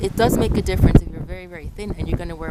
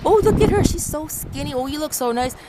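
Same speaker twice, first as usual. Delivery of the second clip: admiring stranger; 7 semitones higher than usual. Retold or imitated. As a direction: lightly imitate a gushing admirer, pitched up. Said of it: "I receive compliments from people".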